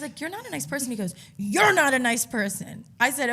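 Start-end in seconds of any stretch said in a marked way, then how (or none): 0.2-0.9 high-pitched, restrained dig; retold prim put-down; passive-aggressive. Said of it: "Then I was passive". 1.4-2.8 loud, slow, higher-pitched parroting; imitated exaggerated jeer; mocking comeback. Bartender mockingly throws insult back.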